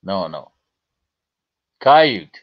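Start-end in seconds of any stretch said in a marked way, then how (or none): 1.8-2.3 Coyote in two syllables, KAI-yoot; local pronunciation. Shown as a demonstration. Trapper correcting the chat, firm demonstration. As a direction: say Coyote firmly, louder, dropping the final ee. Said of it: "That's how you say that".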